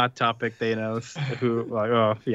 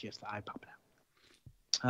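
whisper; 3 semitones lower, 17 dB quieter, faster than usual. Real, not acted. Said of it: "whispered aside".

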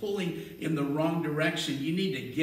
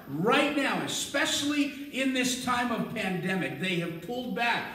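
loud, rising sermon delivery that swells toward a shout; a pastor pumping faith into a hurting crowd, loud and rising; full-force preaching; 4 semitones higher, 5 dB louder than usual. Acted. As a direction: preach it hard to the congregation.

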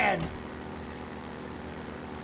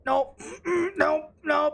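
ordinary talk, nothing marked

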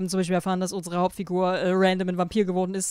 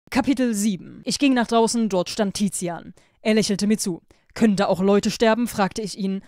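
louder, lively read-aloud narration; story reading; 5 dB louder than usual. A narrator's reading. YouTuber reads a Wattpad story to listeners, lively narration.

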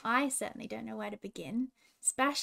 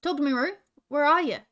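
louder, searching shout; calling out; 3 semitones higher, 7 dB louder than usual. A narrator's reading. Ferguson stumbles between fallen trees, calling out for Roo. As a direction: call out for a missing friend. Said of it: "he called".

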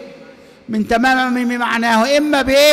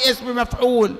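usual speaking voice

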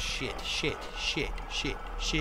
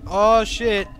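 ordinary delivery

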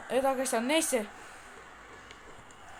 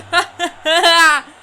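laugh; 11 dB louder than usual. Real, not acted. Laughing after scoring.

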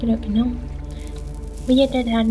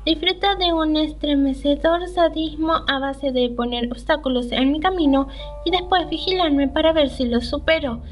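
higher-pitched, performed reading of a character's thoughts; game dialogue read-aloud; 5 semitones higher than usual. Acted. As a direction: read the heroine's inner monologue in a lifted voice. Reads the game character's lines aloud.

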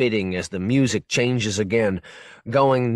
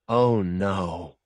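simple dismay; 3 semitones lower than usual. Retold or imitated. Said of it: "In simple dismay".

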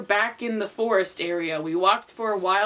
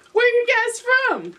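high voice; 12 semitones higher, 3 dB louder than usual. Acted. Voicing Naruto question.

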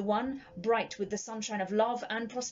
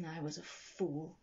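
low, soft murmur of self-reproach; 4 semitones lower, 7 dB quieter than usual. A narrator's reading. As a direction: mutter it to yourself, softer. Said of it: "he murmured with conviction".

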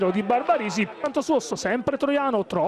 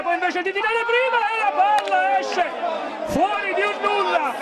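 excited commentary; 6 semitones higher than usual. Real, not acted. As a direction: ride the near miss with rising excitement. Near-miss chances in live commentary.

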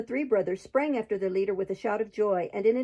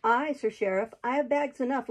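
ordinary delivery

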